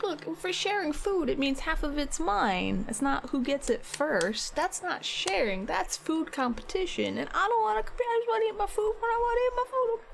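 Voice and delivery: reenacting her exasperated rant at her partner about food; exasperated retold rant. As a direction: replay the frustrated argument, building. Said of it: "It was, like, exasperated yelling".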